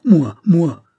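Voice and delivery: high, loud outraged cry; anguished cry. Acted. Accused man crying out against the theft charge, anguished shout. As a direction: cry out in disbelief.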